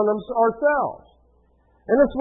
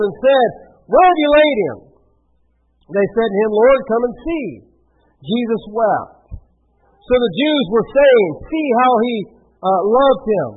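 projected, steady Bible reading; scripture reading; 3 semitones higher, 6 dB louder than usual. A narrator's reading. Preacher reads Martha's meeting with Jesus to the congregation, projected scripture reading. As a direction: read the passage out clearly to the room.

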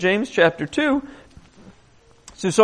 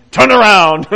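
shouted order; 7 dB louder than usual. Retold or imitated. Commander orders onlookers.

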